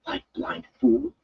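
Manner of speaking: put-on young man surrounded by knives, panicking; frantic curse. A narrator's reading. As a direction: swear at yourself in panic. Matt cursing in the fight.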